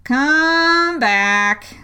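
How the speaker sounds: slow, drawling caterpillar. A narrator's reading. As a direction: languid creature voice calling. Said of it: "the caterpillar called after her".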